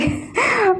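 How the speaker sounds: bursting into a high laugh while telling; real laugh. Real, not acted. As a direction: laughs out at the memory. Laughing while recalling the fun. (खिलखिलाकर हँसी)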